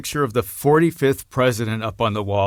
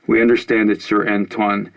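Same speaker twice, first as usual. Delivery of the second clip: louder formal statement read; formal announcement; 5 dB louder than usual. Acted. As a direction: declare it like a press statement. Reads coalition announcement on TV.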